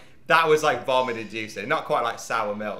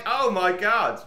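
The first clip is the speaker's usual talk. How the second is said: shocked; 9 semitones higher, 3 dB louder, slower than usual. Retold or imitated. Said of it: "And I was like".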